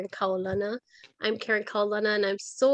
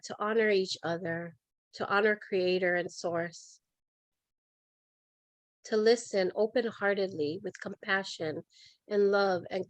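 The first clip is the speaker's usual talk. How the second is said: softly measured meditative prayer with long pauses; calm, reverent invocation; guided meditation; 3 dB quieter than usual. Real, not acted. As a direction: settle listeners into stillness, unhurried and gentle. Leads a breathing and centering meditation.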